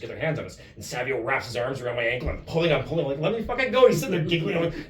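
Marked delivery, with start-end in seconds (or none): 3.2-3.9 high, loud mock protest; exasperated demand. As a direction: act out struggling to be released. Acted plea while held by ankle.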